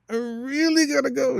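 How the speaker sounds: put-on whiny kid pleading; desperate kid. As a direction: beg the teacher like a kid who must pee.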